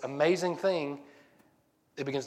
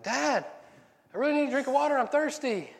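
put-on whiny kid plea; 11 semitones higher than usual. Kid begs dad for water, high whiny call. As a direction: whine up at dad from bed. Kid yelling again, more desperate.

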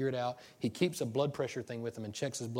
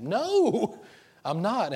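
sharp, much higher, loud denial with a laugh; angry denial; 10 semitones higher, 9 dB louder, much slower than usual. Retold or imitated. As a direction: snap back loudly, half laughing. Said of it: "And I was really angry".

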